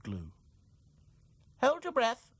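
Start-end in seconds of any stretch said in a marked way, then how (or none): 1.6-2.3 high, cheery doll voice; Dotty voice. A narrator's reading. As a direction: praise him like a chirpy doll. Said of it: "said Dotty".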